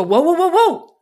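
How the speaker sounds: loud, high run of whoas; acted interruption. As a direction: cut them off, imitating an alarmed halt. Acting ex cutting her off.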